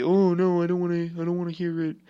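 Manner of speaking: high, fast mock-frightened whining; mock scared whine. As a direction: act out a skittish person, hammed-up and rushed. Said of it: "be scared and be".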